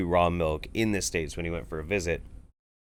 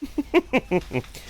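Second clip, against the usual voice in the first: high squealing laugh. Real, not acted. Reacting to video, high laugh.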